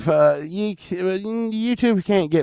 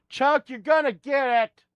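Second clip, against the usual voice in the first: high raised mock threat to the dog; scolding dog; 11 semitones higher than usual. Real, not acted. Streamer warning his dog he'll get it, raised and high. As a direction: call out a playful warning.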